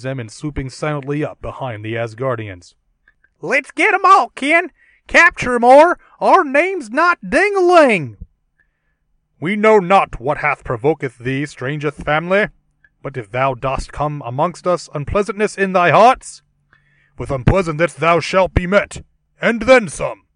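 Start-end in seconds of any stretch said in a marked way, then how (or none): 3.4-8.2 very high, loud cackling granny; hillbilly granny voice. Acted. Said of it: "I guess we can do a dramatic reading". 9.4-20.2 booming Shakespearean hero, louder; archaic heroic voice. Acted. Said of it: "we can do a dramatic reading".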